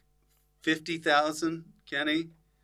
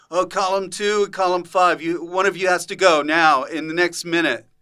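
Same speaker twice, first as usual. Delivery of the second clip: lightly voiced game host, higher and louder; game show host; 3 semitones higher, 6 dB louder than usual. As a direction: announce the elimination like a host. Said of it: "and he'd go".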